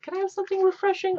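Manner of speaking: high, fast mock plea for something fresh; pleading holiday-weary eater. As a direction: beg for relief from rich food in a quick high voice. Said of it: "you're just like".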